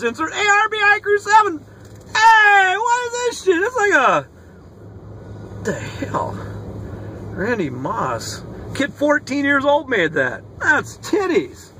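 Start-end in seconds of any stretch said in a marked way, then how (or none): 4.2-8.4 whispered disbelief. Real, not acted. Baffled by odd card.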